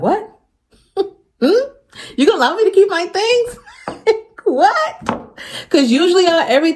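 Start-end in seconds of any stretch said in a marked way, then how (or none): 0.0-3.6 squeaky, high mock disbelief. Retelling her shocked reply to God, high incredulous squeak. 3.9-4.4 short high-pitched giggle; a quick squeaky laugh. Real, not acted. Laughing at her own retold shock, high giggle. 4.4-5.4 high laughing "What?". Real, not acted. Laughing in disbelief at God's answer, high squeak.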